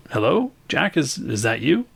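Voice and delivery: high, hopeful call from behind a screen; Karen calling out. Acted. Karen calls to Jack from behind the blind, high and hopeful. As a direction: call out brightly, unsure who it is.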